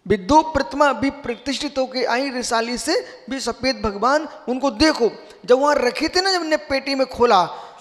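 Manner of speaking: loud, high-pitched sermon delivery; full-force preaching. Real, not acted. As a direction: proclaim the teaching forcefully to the whole assembly.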